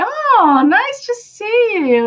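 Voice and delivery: high, stretched "oh" of pleasant surprise; acted happy reunion hello; delighted greeting. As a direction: greet an old friend, pitch right up. Acted greeting of an old friend.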